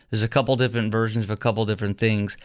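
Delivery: usual speaking voice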